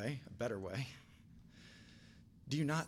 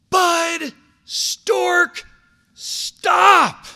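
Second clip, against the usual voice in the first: top-of-the-lungs scream, much higher and louder; screaming at full volume; 15 dB louder, much slower than usual. Retold or imitated. Dad screaming at son before boulevard, full scream. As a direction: scream his full name as loud as you can. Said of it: "as loud as I can".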